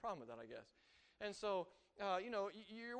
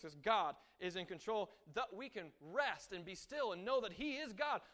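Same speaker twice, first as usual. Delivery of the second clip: usual speaking voice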